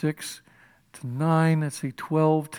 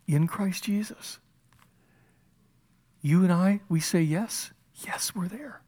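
hushed affirmation; 3 semitones higher, 3 dB quieter than usual. Real, not acted.

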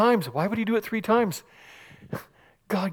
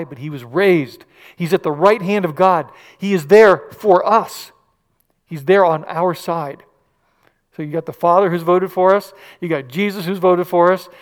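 full-force preaching; 7 dB louder than usual. Acted.

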